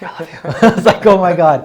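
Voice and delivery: high, loud, laughing outburst; squealed relief, half laughing; retold freaking out. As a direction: re-enact giddy, overjoyed shouting through laughter. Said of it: "Freaking out".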